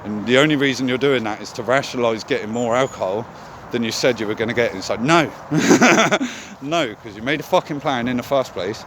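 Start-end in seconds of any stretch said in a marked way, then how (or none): 5.4-6.6 a high burst of laughter; self-mocking high laugh. Real, not acted. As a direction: laugh at yourself. Laughs at own reasoning.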